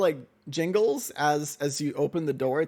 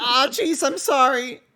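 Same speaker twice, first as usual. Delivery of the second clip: put-on much higher, louder, slow fretful boy; flustered Mortimer; 9 semitones higher, 7 dB louder, slower than usual. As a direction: fluster and falter. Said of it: "Mortimer will say".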